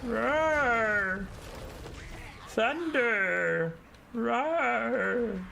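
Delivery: high, drawn-out battle cry; unit voice lines. Retold or imitated. Gamer imitates the Thunder Tusk's voice lines, high and drawn out. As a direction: bellow like a monster unit. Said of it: "Those are the official voice lines".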